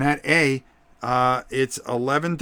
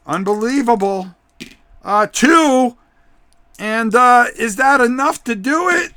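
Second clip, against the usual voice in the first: louder, rising to a shouted No; wrestling commentator; 8 semitones higher, 11 dB louder, slower than usual. Acted. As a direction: ringside call building to a scream. Count called to a shouted No.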